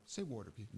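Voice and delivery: "water" said wor-ter with an extra R; Delco water. Shown as a demonstration. Host demonstrating the local accent, R in water. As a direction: say "water" as wooder with the R. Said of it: "you put R's where they don't".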